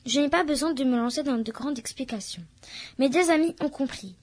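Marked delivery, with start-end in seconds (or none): none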